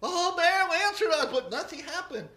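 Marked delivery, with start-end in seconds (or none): 0.0-1.2 crying out. Acted. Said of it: "they cried out".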